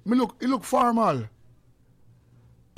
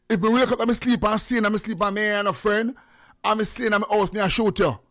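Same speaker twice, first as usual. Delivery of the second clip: louder, higher, insistent declaration; raised voice, pitched up; emphatic repeat; 6 semitones higher, 5 dB louder than usual. Real, not acted. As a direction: hammer the point home loudly. Repeats his statement with more force.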